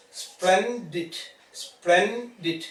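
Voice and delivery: "Splendid" as two separate beats, splen, did; split into syllables. Shown as a demonstration. Teacher showing two natural divisions, "Splendid" split in two. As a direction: break "Splendid" after the n, both times. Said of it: "Two natural division are coming".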